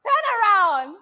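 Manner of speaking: high, loud shout to turn around; calling out. Real, not acted. Shouting at the guest to turn around, loud and high. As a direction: call across the room to him.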